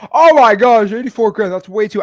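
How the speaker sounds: loud, high mock gasp of outrage; hammed-up shocked cry at the price; mock shocked seller. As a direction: play the seller floored by the lowball number. Acted seller shocked at low offer.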